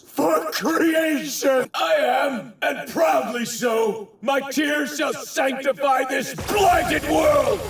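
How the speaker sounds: high, ringing zealot's declaration; grandiose proclamation. Acted. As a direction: proclaim your holy mission at full voice. God proclaims his sacred mission.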